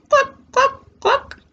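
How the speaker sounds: very high clucking hen; chicken clucks. Acted. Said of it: "says the chickens".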